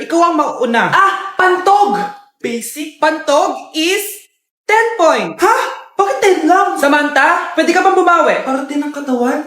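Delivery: ordinary talk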